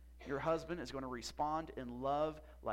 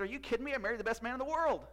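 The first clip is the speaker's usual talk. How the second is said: high, emphatic retold exclamation; incredulous delight; 8 semitones higher, 6 dB louder, faster than usual. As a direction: imitate a wife bursting out in happy disbelief. Wife's delighted reversal, retold.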